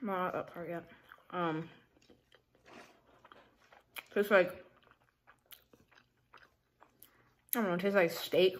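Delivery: chewing while talking; speaking between bites; mouth full. Real, not acted. Seen 0: Bites burger at -1, then talks with closed, working, full mouth.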